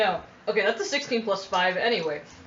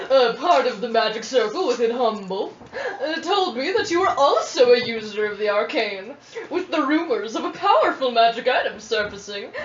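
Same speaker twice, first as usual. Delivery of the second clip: bright squeaky sing-song welcome. Acted. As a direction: read it sweet and sing-song. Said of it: "Would you read it aloud?".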